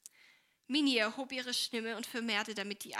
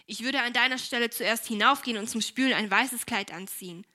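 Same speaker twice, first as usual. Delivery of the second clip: louder, unfriendly advice; 8 dB louder than usual. A narrator's reading. Esther advises her sister at the sink, unfriendly. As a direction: put some force into a cool suggestion. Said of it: "in keineswegs freundlichem Ton".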